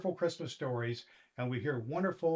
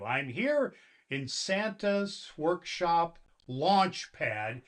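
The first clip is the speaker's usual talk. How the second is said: ordinary delivery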